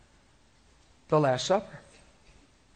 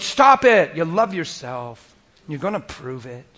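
rebuke; 9 dB louder, slower than usual. Retold or imitated. Jesus rebuking John.

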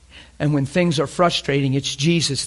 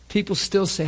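ordinary talk, nothing marked

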